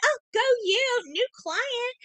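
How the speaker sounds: high sing-song fake enthusiasm; sarcastic high cheer for their news; mock cheer. Fake congratulation to law firm.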